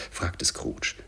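ordinary delivery, nothing marked

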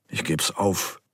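a hint of a growl, understated. A narrator's reading. Karl gives up on the giggling children, mild growl. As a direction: grumble it lightly, giving up. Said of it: "knurrte Karl".

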